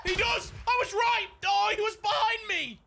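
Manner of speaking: high, excited shouting; excited exclamation. Real, not acted. Excited reaction mid-game.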